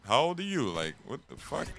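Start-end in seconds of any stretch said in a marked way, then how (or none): 0.1-0.7 a bit higher, lightly voiced stern dad; hint of a suspicious father on the phone; only mildly put-on dad voice; mock dad. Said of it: "Or the dad and shit".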